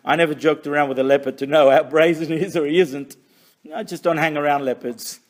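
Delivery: normal talking voice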